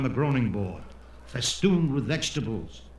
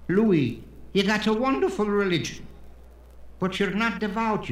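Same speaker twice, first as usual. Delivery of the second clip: higher-pitched, preachy compliment turning to reproach; pious dinner guest; 7 semitones higher than usual. Acted. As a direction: lecture the host from a higher pitch.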